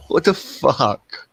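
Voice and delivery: drawn-out, slow what the fuck; slow incredulous repeat; slow disbelief. Real, not acted. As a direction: stretch each word, stunned.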